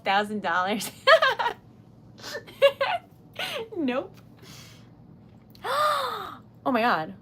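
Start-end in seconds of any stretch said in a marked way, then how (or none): 1.0-1.5 high shrieking laugh; sudden high laugh bursts out; burst of laughter. Real, not acted. Laughing at price. 5.6-6.4 sharp high surprised gasp. Real, not acted. Reacting to a donation.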